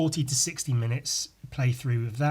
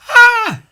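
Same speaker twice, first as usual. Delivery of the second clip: much higher, very loud scream; 16 dB louder than usual. Real, not acted. Vlogger testing his scream on his failing voice, loud scream. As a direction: let out a full scream. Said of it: "I'm trying to scream".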